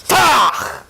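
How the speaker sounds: loud clipped tach for an elbow hit; sharp jab sound. Acted. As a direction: snap out the impact noise.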